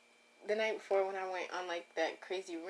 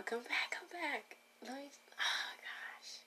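whisper; 6 semitones higher, 7 dB quieter, slower than usual. Acted. Calling her lost words back.

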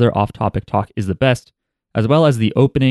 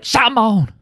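a much higher yelped shamon; 12 semitones higher than usual. Retold or imitated. Joking that the Indiana accent sounds like this, a high yelp. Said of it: "So the Indiana accent is like".

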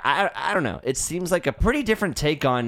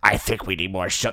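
same odd fish-guy voice; fish man; 5 semitones lower, faster than usual. Acted. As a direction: repeat the low fishman line. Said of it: "That's what he says".

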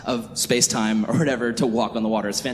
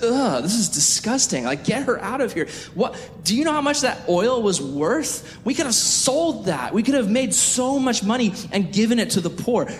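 high-pitched indignant protest; appalled, whining objection; Judas, disgusted; 9 semitones higher than usual. Retold or imitated. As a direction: complain in hammed-up outrage, voice way up. Acted outraged Judas.

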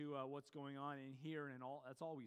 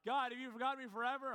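loud, raised-pitch plea to heaven; anguished cry; 8 semitones higher, 9 dB louder, faster than usual. Acted. Discouraged believer asking if God has forgotten them, crying out. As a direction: cry out the question, high and loud.

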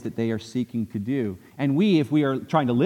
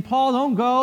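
tearful plea; 9 semitones higher, 3 dB louder, slower than usual. Retold or imitated. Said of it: "they're crying and they're saying".

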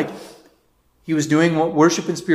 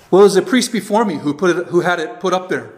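higher, louder mock excuse, laughing at the end; sheepish excuse; 5 semitones higher, 6 dB louder than usual. Priest blames his predecessor to the teacher, sheepish and laughing. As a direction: pass the blame with a nervous laugh.

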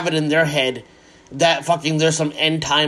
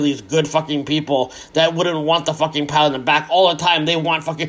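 ordinary talk, nothing marked

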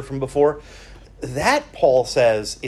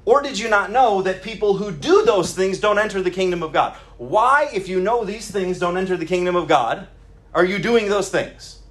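raised, insistent preacher's scolding; indignant rebuke; 6 semitones higher, 3 dB louder than usual. Acted. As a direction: press each question home in a higher voice. Voicing Paul's rebuke to his readers.

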